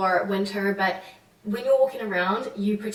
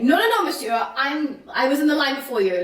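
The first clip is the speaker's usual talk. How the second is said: loud, higher, firm mock scolding; firm telling-off; 6 semitones higher, 6 dB louder than usual. Imitating a French woman scolding a man who cut the line, loud and firm. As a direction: put a queue-jumper firmly in his place. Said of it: "very firm".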